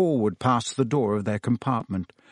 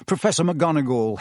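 ordinary delivery

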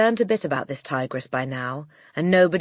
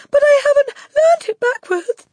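put-on very high, tearful boy's wail; Wilfred; 11 dB louder than usual. A narrator's reading. Boy protesting to the headmistress, high tearful panic. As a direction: wail it in a squeaky, frightened voice. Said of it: "stammered Wilfred".

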